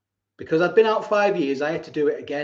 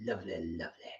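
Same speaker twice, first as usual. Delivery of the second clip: soft murmur; 10 semitones lower, 17 dB quieter than usual. Real, not acted. Savouring whispered repeated word.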